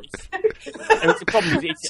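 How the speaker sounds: laughing while talking; breaking into high laughter. Real, not acted. Laughing through cramp pain.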